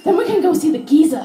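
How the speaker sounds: the mum sure of herself; mimicking mum. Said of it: "She said".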